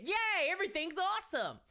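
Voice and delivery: mock cheer. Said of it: "which is it, Kevin".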